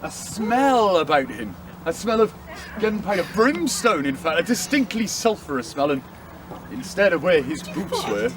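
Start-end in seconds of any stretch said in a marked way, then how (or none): none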